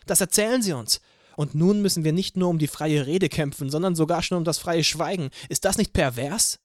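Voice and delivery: rally the crowd from the rooftop; rousing speech. Acted. Anna harangues the crowd from the roof, loud rousing speech. Anna's speech through a microphone.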